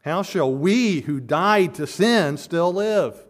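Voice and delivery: slow and loud, punching "we"; emphatic stress on we. Shown as a demonstration. Preacher rereading the verse with the emphatic pronoun, heavy stress on "we". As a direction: hit "we" hard, then "died" and "sin". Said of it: "It's emphatic".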